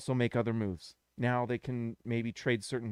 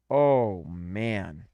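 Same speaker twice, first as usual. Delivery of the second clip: ordinary talk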